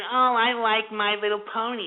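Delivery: lightly put-on mocking fan voice; mocking brony. Host imitating brony fans, lightly mocking voice. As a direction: lightly mimic a fan, trailing into taunting whine. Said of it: "trying to be pseudo about it by saying".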